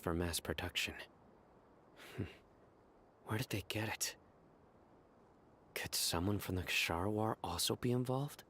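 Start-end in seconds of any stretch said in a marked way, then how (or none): 2.1-8.4 hushed whisper to himself; whispering a private suspicion; whispered musing. Acted. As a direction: muse under his breath. Thinking aloud about a suspect.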